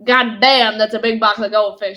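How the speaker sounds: country twang. Retold or imitated. Daughter re-enacting her loud country-twang exclamation at the huge goldfish box. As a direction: blurt it like a Texan seeing a giant box of goldfish. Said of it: "I hear Amber say that in almost a country twang style".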